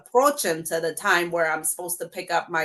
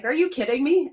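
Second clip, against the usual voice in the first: indignant disbelief; 7 semitones higher than usual. Retold or imitated. Told lawyer's price, indignant.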